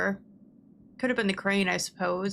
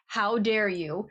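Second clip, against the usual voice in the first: mock outrage, drawn out; slower than usual. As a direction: stretch out "How dare you?" like a wounded diva. Mock outrage, second take.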